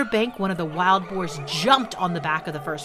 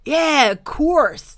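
higher, louder, slow crocodile voice; obliging crocodile; 8 semitones higher, 6 dB louder, much slower than usual. Acted. Crocodile happily agreeing, slow and grand.

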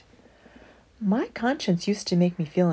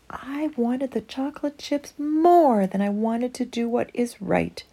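usual speaking voice

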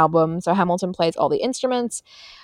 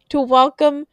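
put-on transatlantic accent, raised pitch; old Hollywood transatlantic accent; golden age film star; 4 semitones higher than usual. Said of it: "do my transatlantic accent".

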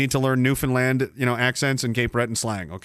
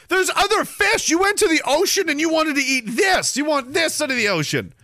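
appalled rant; 12 semitones higher than usual. Retold or imitated. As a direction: rail at them like an appalled kid. Ranting as appalled child.

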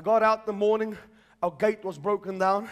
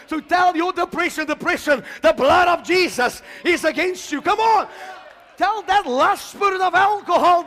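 loud, rising sermon climax; full-force preaching; 5 semitones higher, 8 dB louder than usual. Acted. Preacher declaring victory to the congregation, full-force preaching. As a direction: preach it out to the whole church, louder and higher.

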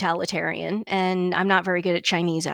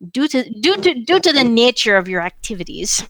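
Russian accent; 4 semitones higher than usual. Shown as a demonstration. Co-host spicing up his new buzz line, mock Russian accent. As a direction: put on a spicy Soviet Russian accent. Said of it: "like, a Russian accent onto that".

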